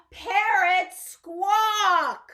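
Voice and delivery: high, loud, slow reveal; excited reveal. Acted. Teacher revealing the parrot to children. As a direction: draw it out with wonder. Reading pop-up book excitedly.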